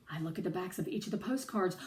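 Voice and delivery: plain reading voice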